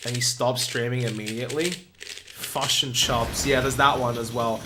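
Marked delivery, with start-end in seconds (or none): 1.7-3.4 slow, caricatured Kiwi vowels; exaggerated Kiwi accent. Shown as a demonstration. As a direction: drag it out in a cartoon New Zealand accent. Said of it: "not heard a Kiwi say it weird".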